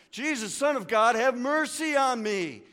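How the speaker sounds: high, loud, slow yell for mercy. Retold or imitated. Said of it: "he yells for Jesus' attention even louder".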